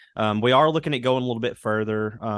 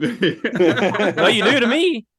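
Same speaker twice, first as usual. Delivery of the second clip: high, loud, laughing comeback; laughing retort; 11 semitones higher, 5 dB louder, much slower than usual. Retold or imitated. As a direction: fire it back through laughter.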